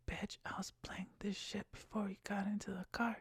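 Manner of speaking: high, hushed whisper; whispered inner thought. Retold or imitated. Driver thinking to himself about the passenger, whispered inner thought. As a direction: whisper it as a private retort. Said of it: "I'm just thinking to myself".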